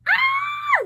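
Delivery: very high squeal; embarrassed squeal. Real, not acted. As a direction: squeal as if caught out. Squeal before embarrassing story.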